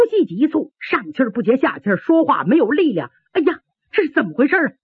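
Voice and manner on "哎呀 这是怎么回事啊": high, very fast worried exclamation; alarmed. Acted. Injured old fighter wonders at his failing breath, alarmed. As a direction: blurt it in rising alarm.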